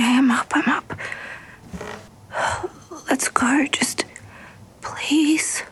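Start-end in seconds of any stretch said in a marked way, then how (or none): 0.6-0.9 whispering. Acted. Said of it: "let's use our quiet time voices".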